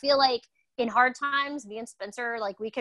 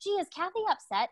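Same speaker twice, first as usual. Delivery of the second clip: high, tiptoeing mock question; lightly mock a timid spouse; mock timid asking; 9 semitones higher than usual. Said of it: "there's no, like".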